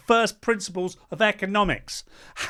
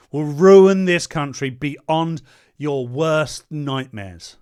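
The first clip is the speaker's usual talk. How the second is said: very slow, weighty, louder warning; slow dire warning; 4 semitones lower, 4 dB louder, much slower than usual. Real, not acted. Warning viewers about the Greens, slow and grave. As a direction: lean on every word.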